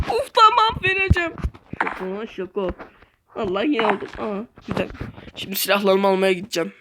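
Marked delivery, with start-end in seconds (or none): 1.8-6.7 low character voice. Acted.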